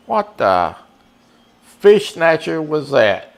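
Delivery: high, slow disbelieving exclamation; stunned outburst. Real, not acted. Streamer to chat after a missed fair ball, stunned and slow. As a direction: drag it out in shock at the replay.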